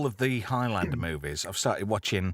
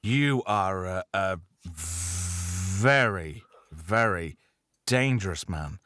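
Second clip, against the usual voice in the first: slow mock-solemn; 4 semitones lower, much slower than usual. Teasing host slowly.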